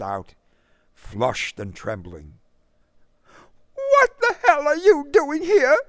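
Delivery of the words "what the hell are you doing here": very high, loud crying shout; angry tearful cry. Acted. Marie cries angrily at the suitor outside her house. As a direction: scream it through angry tears. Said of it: "she cried".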